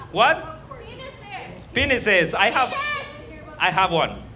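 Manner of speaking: ordinary delivery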